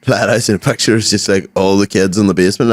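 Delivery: usual speaking voice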